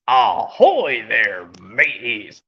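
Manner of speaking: slow pirate hail, dropping low at the end; pirate captain greeting. Acted. Captain Scallywag greeting his mateys, piratical hail. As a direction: hail the crew in a drawn-out pirate voice. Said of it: "I'm Captain Scallywag".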